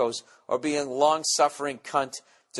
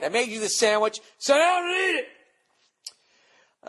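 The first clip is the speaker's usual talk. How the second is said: much higher, slow, drawn-out mock mom order; stern mother; 13 semitones higher, 4 dB louder, slower than usual. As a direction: imitate mom laying down the law. Mother voiced in the story.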